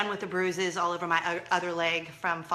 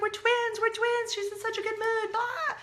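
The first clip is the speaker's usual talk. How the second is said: much higher, louder giddy chant; excited kid; 4 dB louder than usual. Retold or imitated. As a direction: squeal it like a thrilled eleven-year-old. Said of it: "She's like".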